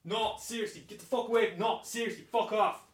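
high, distant, muted protest; angry off-mic outburst. Acted. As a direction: warn them off from far away. Shouting from outside the studio.

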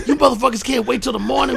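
high, loud exasperated yell; angry Branford. Retold or imitated. Branford snapping at roommates from the couch, high and loud. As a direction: scold the roommates for waking you. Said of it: "it's Branford going".

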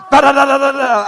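loud, high burst of "da-da-da" gunfire; rapid gunfire imitation. Preacher acting out emptying the gun, loud gunfire imitation. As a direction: fire off a whole clip with your voice.

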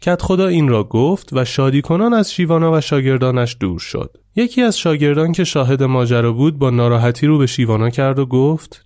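ordinary delivery

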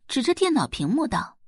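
plain reading voice

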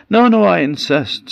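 high hammed-up politeness; mock insistence. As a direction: insist with exaggerated courtesy. Polite argument over who goes first.

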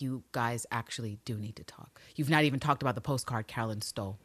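hushed whisper to the screen; whispered aside. Retold or imitated. Viewer whispering advice to the couple. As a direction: whisper it like talking to the TV. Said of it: "I was like".

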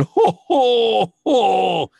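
high, loud puppet laugh "jo, jo, jo"; Alf's laugh in Spanish. Retold or imitated. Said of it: "Y yo hacía". (risa aguda y fuerte de Alf en español)

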